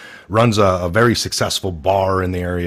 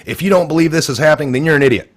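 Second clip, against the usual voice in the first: mock scolding; 8 semitones higher, 6 dB louder, faster than usual. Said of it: "people on social media".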